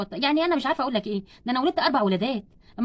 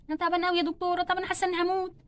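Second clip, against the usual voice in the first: soft, higher labouring whimper; weak and suffering; 3 semitones higher, 5 dB quieter than usual. Acted. Patient in labour tells doctor she's dying, weak and pained. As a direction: complain faintly as if your strength is gone.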